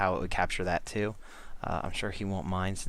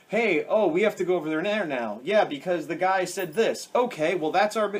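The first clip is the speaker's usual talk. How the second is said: higher, louder hammed-up plot-speak; mock expository dialogue; 7 semitones higher, 5 dB louder than usual. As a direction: act out clunky exposition, pitched up. Mocking clumsy expository dialogue.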